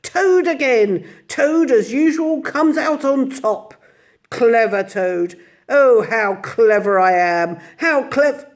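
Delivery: high, loud self-praise; gloating Toad. A narrator's reading. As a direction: crow over his cleverness. Toad gloating after escape.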